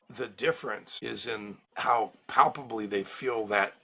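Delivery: ordinary delivery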